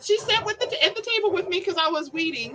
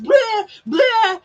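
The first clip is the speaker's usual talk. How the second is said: blurted mock dog outburst, growing louder; crazy dog; 7 semitones higher, 8 dB louder than usual. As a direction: act out a wild dog with nonsense noise. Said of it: "he was crazy and just".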